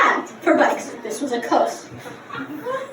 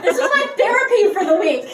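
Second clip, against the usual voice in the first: normal talking voice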